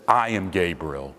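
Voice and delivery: "I am Gabriel" stated low and final; emphatic. Acted. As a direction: answer with a low, falling "I am Gabriel". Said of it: "it too is in the emphatic".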